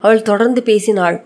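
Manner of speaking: ordinary delivery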